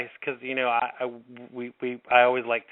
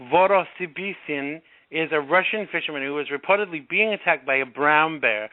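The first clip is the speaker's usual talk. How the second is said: normal talking voice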